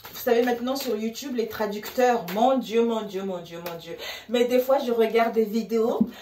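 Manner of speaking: normal talking voice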